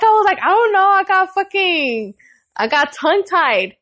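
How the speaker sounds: high, laughing through the words. Real, not acted. Telling friends she got tongue-tied, laughing high. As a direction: giggle your way through the excuse. Embarrassed, retelling while laughing.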